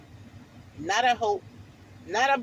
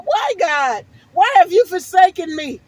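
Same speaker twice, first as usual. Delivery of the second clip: rising, louder desperate plea; crying out to God; 5 semitones higher, 5 dB louder than usual. Retold or imitated. Retelling her prayer to God, pleading louder and higher. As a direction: build from pleading to a loud cry. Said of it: "I'm crying out to God".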